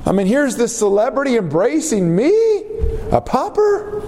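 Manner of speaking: squeaky high, awed commoner; a dazzled peasant marvelling at his idol; starstruck pauper. Acted. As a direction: gush like a nobody hugged by a celebrity. Preacher voices a starstruck commoner.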